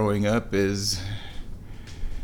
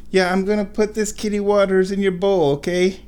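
higher, gentle pet voice; 6 semitones higher than usual. Real, not acted. Owner telling her cat about the water in baby talk. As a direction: promise the kitty sweetly.

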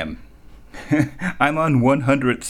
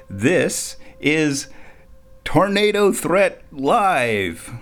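announcer voice; 6 semitones higher, much slower than usual. Acted. Radio host announcing the show title.

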